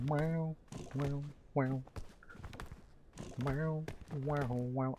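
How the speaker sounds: very soft breathy wows; awed whisper. Real, not acted. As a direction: hush in awe at the view. Awed by the scene.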